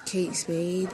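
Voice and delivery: muttered price in a whisper. Real, not acted. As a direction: whisper it under breath. Reading price tag.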